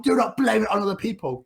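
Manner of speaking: shrill it out like a cross teacher; mock scolding teacher. Maths teacher scolding Tom, high mock scold. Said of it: "teacher scolding in retold scene".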